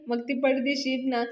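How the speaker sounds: usual speaking voice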